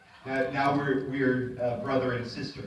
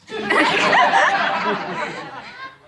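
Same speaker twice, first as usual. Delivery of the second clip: burst of laughter; 13 dB louder than usual. Real, not acted. Group giggling before greeting.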